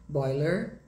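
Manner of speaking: American "boiler", final R sounded, rising; sotaque americano. Shown as a demonstration. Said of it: "em inglês americano". (sotaque americano, com R final)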